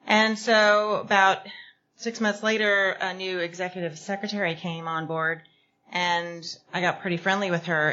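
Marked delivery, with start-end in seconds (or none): none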